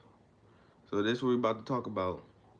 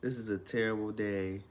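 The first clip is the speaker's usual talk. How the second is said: gloomy; 3 semitones lower, 8 dB quieter than usual. Shown as a demonstration. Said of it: "if you wake up and be like".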